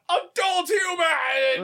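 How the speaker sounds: drawn-out high cry of adult human; whiny shout. Retold or imitated. Roommate mimics pets waking him with a slow high cry. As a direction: wail it slowly like a needy pet. Kids wake, mimicking their demand.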